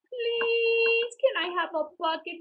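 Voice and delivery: put-on high begging little boy; pleading child. A narrator's reading. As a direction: beg like a small child. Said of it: "he asked".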